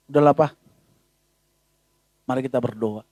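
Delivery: low, soft, gentle comfort; calm reassurance. Acted. Faithful wife gently calming her husband, low and soft.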